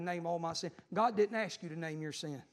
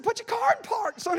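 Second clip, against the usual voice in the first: calling out; 8 dB louder than usual. Retold or imitated. Telling man to park rolling car.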